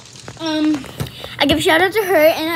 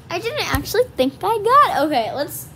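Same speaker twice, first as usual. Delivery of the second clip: ordinary talk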